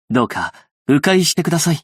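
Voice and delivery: ordinary delivery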